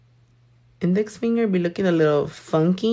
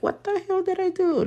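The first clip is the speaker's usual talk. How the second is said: high-pitched, softer mock-innocent protest; mock bewilderment; 12 semitones higher, 5 dB quieter than usual. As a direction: play baffled in a high voice. Acting out puzzled reaction to clients.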